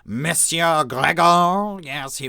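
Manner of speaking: slow, high, loud French "Monsieur Gregor"; better French accent. Shown as a demonstration. Narrator tries the editor's name again in a hammed-up French accent. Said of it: "let me do a better one".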